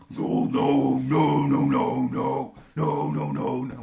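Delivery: loud repeated no, no; emphatic no. Real, not acted. Hosts shouting down a silly band idea, loud repeated no. Comic frantic rejection of idea.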